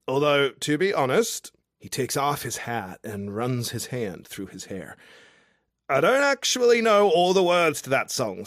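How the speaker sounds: a tipsy outback charmer calling up to a window; drunk Aussie guy. Acted. Drunk Australian guy below her window, boisterous and cheeky.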